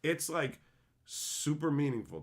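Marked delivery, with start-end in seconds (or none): none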